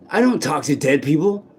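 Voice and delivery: usual speaking voice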